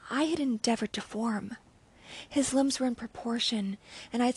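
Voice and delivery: sudden full-voiced dramatic reading; intense narration. A narrator's reading. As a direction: break out of the whisper with dread. Narration rises from whisper.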